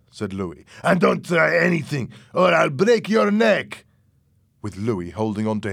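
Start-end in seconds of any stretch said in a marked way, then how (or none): 0.8-3.8 high, loud warning, slowing at the end; angry, forceful menace; shouted threat. A narrator's reading. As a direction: threaten him at full voice. Louis threatens Fernando.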